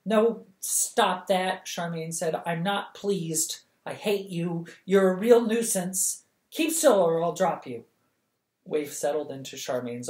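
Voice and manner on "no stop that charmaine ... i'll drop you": scolding. Acted. Scolding the licking dog.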